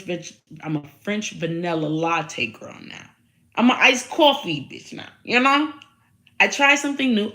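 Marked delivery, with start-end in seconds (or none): 3.6-7.3 high and loud, bursting with glee; excited boast. Real, not acted. Host bragging to viewers about iced coffee, high and loud. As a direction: crow about the new coffee.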